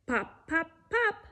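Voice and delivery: playful cork pops rising up; popping cork. Pretend. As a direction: pop like a cork flying. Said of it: "like a cork".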